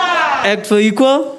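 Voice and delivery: high, slow slogan call; rallying call. Real, not acted. Host leading the crowd in a slogan, rallying call.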